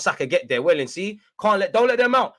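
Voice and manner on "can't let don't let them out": high, loud plea to the players; worked-up, raised-voice urging; urgent shout. Real, not acted. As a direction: yell at the team to press.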